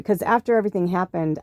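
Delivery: usual speaking voice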